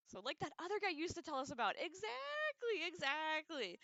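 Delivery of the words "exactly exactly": high, drawn-out emphatic agreement. Real, not acted. Streamer agreeing with a viewer's comment, high and emphatic. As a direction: stretch each word out, pitched up.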